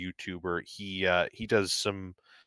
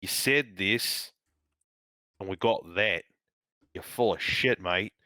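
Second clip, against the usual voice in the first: put-on Australian accent. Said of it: "Can't tell if I'm doing a Kiwi accent or an Australia accent".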